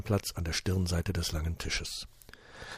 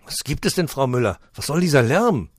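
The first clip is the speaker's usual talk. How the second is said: louder, higher stern director; a hint of an annoyed director; 6 semitones higher, 9 dB louder than usual. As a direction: demand what the noise is. Director confronts the noise. (leicht gereizter Heimleiter)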